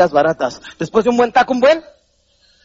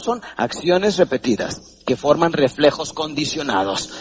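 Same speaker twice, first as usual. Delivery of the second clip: usual speaking voice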